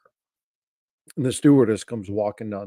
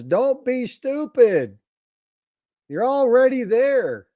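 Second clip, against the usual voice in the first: high, slow put-on voice of a know-it-all; joke blonde voice; 13 semitones higher, much slower than usual. Joke teller playing the other blonde, high and silly. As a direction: deliver the punchline in a high silly voice. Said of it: "And the other one says".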